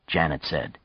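ordinary delivery

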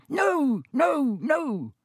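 very high, frantic elf squeak. Acted. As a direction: squeak out each refusal. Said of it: "squeaked Dobby".

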